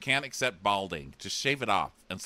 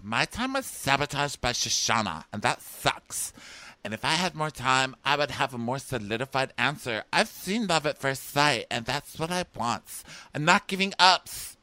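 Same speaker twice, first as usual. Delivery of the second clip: raised-pitch mock complaint, building louder; higher, hammed-up mom voice; whiny mom parody; 6 semitones higher, 4 dB louder than usual. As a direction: imitate an aggrieved contestant venting.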